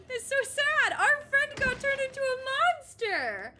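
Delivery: high, loud hammed-up wail; mock wailing. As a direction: wail it like a fake sob. Hammed-up wailing about Six.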